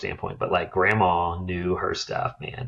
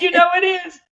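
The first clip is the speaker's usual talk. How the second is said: much higher and louder, cocky; smug artist; 7 dB louder than usual. Retold or imitated. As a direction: fire it back with total confidence. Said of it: "he's like".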